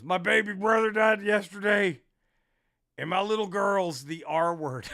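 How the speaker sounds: hammed-up anguished yell; distraught heckler. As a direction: act out an upset heckler yelling. Upset heckler takes the mic.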